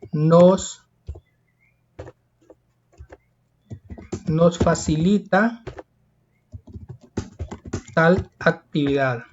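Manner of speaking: slow dictation, long pauses between chunks; measured, halting teacher's dictation; dictation pace. Shown as a demonstration. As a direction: dictate it in small chunks, leaving time to write. Teacher dictating an answer slowly.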